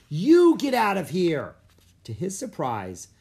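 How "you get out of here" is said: high, loud, slow retort; fed-up, emphatic shout; angry yell back. A narrator's reading. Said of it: "He whirled around and yelled".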